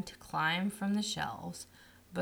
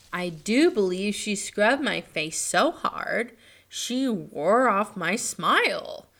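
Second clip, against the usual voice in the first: louder doll voice, slow end; gently complaining rag doll; 2 semitones higher, 7 dB louder than usual. A narrator's reading. Raggedy Ann speaks.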